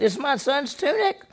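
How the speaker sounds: high, anguished cry of loss; a father seeing his son's bloody coat; grieving father. Retold or imitated. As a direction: Jacob crying out over Joseph's tunic. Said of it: "He said".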